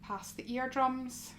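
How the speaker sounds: soft, unhurried yoga guidance with long pauses; guided meditation. Acted. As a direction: gently guide them into stillness, phrase by phrase.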